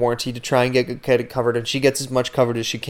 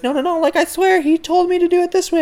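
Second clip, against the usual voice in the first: much higher put-on girl's voice, protesting; imitating the receptionist. Mimicking the receptionist swearing the mechanic told her so. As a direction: squeak out her innocent insistence. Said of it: "And she's like".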